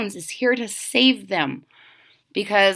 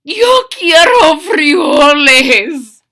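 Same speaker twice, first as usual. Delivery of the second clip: slow booming proclamation; grand declaration; 5 semitones higher, 9 dB louder, slower than usual. Acted. Don Diego declaring to the crowd, solemn and booming. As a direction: declare it like a grave leader. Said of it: "in his most somber voice declared".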